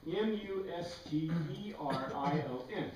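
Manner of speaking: letter-by-letter spelling for the class; spelled letter by letter. Shown as a demonstration. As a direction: spell M U S T E R I O N out one letter at a time. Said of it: "This word we've got to always remember".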